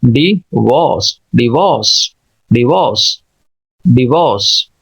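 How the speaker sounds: डिवोर्स as di-VAWS, no r; British silent r. Shown as a demonstration. Teacher drills divorce for learners, British silent r. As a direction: drop the r, stress the second syllable. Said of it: "आर को नुमाया नहीं करते".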